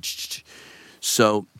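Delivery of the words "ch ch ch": soft, quick hissed bursts; imitated squib bursts. Actor shows how the squibs went, soft imitated bursts. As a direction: mimic little charges going off in a row. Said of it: "as they're going".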